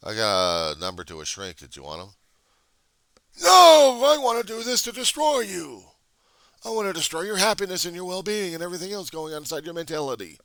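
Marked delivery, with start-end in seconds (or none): 3.4-10.4 much higher, loud gloating heckler voice; mock heckler. Heckler vowing to destroy his happiness, gloating mock voice. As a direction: gloat like a hateful troll.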